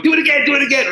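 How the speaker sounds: high, rapid excited plea; eager pleading. Retold or imitated. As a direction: retell it like a thrilled kid begging for a trick. Retelling his eager plea to priest.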